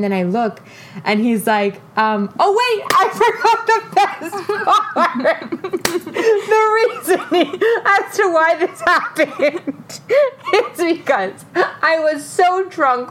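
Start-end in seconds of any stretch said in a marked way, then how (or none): 2.4-13.1 high-pitched, breaking up with laughter; giggling too hard to talk; laughing while talking. Real, not acted. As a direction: squeeze the words out through helpless laughter. Cracking up while telling the story.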